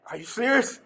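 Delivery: half-laughing as the news sinks in; laughing disbelief. Retold or imitated.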